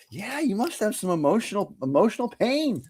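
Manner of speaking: high hammed-up insistence; mock healer. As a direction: play the sure healer, high. Acupuncturist insists.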